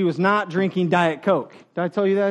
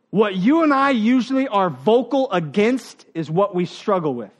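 ordinary talk

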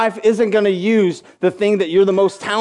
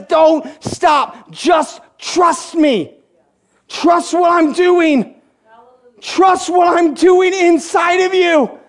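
higher, slow, shouted insistence; God's urgent plea; 7 semitones higher, much slower than usual. Retold or imitated. God urging the doubting believer to trust him, slow shout. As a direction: plead with them to trust, full force.

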